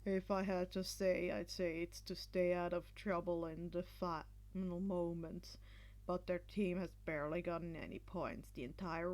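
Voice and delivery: low, tired, deadpan voice; dry commentator. A narrator's reading. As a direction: Eraserhead drily comments on the match, low and flat. Eraserhead commenting.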